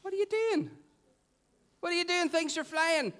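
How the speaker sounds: high-pitched incredulous outcry; exclaiming. Acted. Disciples finding Jesus after searching for him, exclaiming. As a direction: exclaim it high and urgently, like baffled followers. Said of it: "they exclaimed".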